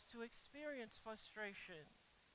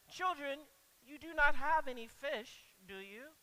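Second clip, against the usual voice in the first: louder, higher gentle scolding; mild rebuke; 4 semitones higher, 9 dB louder than usual. Acted. Jesus to the fishless disciples, a mild rebuke. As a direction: chide them kindly, like a parent. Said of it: "The actual tone of Jesus here is".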